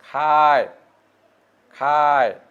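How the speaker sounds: খায় held long, gliding into its final য়; drawn-out word. Shown as a demonstration. Teacher demonstrating the verb ending, খায় drawn out. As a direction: stretch out each খায়. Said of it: "এখানে অন্তঃস্থ".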